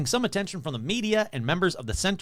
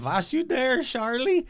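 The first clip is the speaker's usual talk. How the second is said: Jack Pearl's fake German accent, pitched up; lightly hammed German accent, higher; German accent quote; 9 semitones higher than usual. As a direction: mimic the old radio catchphrase in a hammy German accent. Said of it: "He said".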